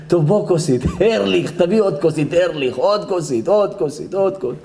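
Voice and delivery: lightly hammed-up drinker's call; mock groom calling for drinks. Stressed groom asks Erlich for another drink, mock pleading. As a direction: call out repeatedly for another glass, playfully.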